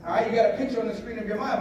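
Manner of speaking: ordinary delivery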